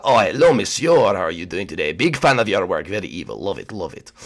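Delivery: hammed-up French accent, dropping low; French-accented cartoon chef voice; French Koopa. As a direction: greet as a fawning Frenchman. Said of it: "this is French Koopa".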